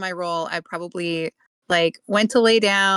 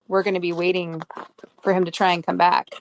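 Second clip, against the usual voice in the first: ordinary talk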